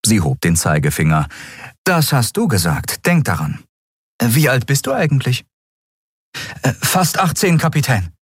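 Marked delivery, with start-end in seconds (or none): 1.8-5.4 higher female captain voice; calm and matter-of-fact; captain Andriel. Acted. Captain to Miko about the quarters, higher voice. 6.6-8.1 put-on high, breathless eager boy. Miko to the captain promising anything, eager and high.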